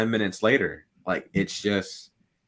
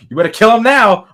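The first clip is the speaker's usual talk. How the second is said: louder and higher, egging him on; urging on; 13 semitones higher, 12 dB louder than usual. Retold or imitated. Moviegoer next to him urging the hero, loud and high. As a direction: cheer him on to finish the villain. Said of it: "saying, like".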